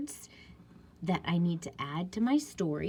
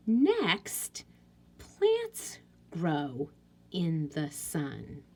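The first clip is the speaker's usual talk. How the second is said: slow read-aloud; 3 semitones lower, much slower than usual. Shown as a demonstration. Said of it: "reading sentence aloud slowly".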